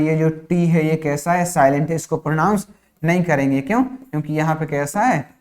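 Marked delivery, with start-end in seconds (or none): none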